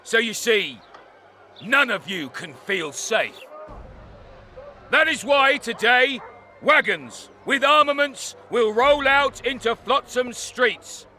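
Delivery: public proclamation. Acted. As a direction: declare it like a commander rallying the town. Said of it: "Nice speech".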